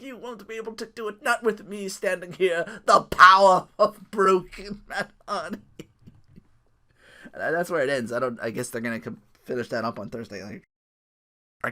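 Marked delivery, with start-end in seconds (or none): 0.0-5.6 higher, slow grand boast; theatrical threat. Retold or imitated. Mimicking Matt Hardy blocking the jump, slow grand boast. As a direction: boast like a mad wrestler.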